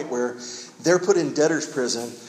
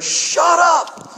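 high, loud mock holler; imitated yell; 9 dB louder than usual. Condo neighbor to man praying loudly, imitated yell. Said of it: "he yells out".

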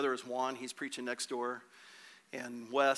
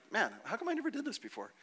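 high-pitched mock wonder, quick and light; playful astonishment, pitched up; acted amazement; 8 semitones higher than usual. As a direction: marvel in a high voice, as if it were easy. Voices an amazed new tongues speaker.

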